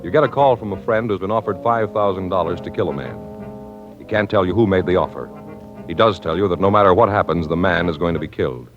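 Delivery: ordinary delivery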